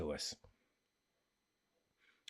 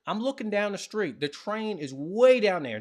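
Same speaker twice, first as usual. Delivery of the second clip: normal talking voice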